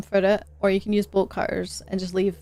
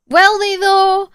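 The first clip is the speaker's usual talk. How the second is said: sarcastic; 9 semitones higher, 6 dB louder than usual. Real, not acted. Skeptical retort.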